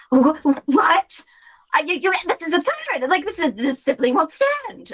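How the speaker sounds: high, sputtering outrage; high sputtering indignation; outraged Celia. Acted. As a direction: splutter in high disbelief.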